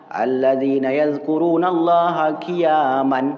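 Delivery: slow chanted Arabic verse; Quran recitation. Shown as a demonstration. Preacher recites the Quran verse, slow and chanted.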